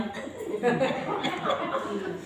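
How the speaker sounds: real, soft chuckle; amused laugh. Real, not acted. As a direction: laugh quietly at your own story. Amused by own carrot anecdote. (धीमी, सच्ची हँसी)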